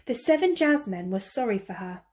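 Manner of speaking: usual speaking voice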